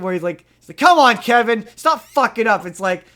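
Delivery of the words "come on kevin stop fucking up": mock angry yell. Quoting angry character at Kevin.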